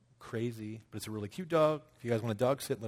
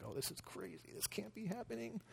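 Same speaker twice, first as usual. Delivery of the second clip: hushed disbelief; 9 semitones higher, 13 dB quieter than usual. Retold or imitated. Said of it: "she's like".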